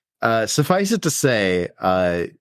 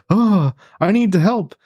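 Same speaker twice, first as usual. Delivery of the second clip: higher, louder mock plea; mock heroic plea; 10 semitones higher, 4 dB louder than usual. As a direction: ham up the hero's urgency. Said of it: "And it's just like".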